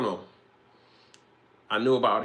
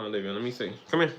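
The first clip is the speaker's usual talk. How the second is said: ordinary talk, nothing marked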